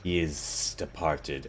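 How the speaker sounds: slow, soft and low, a monster's calm; low creature voice. Acted. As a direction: speak low and slow like a brooding beast. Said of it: "feels forward and says".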